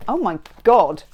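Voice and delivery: normal talking voice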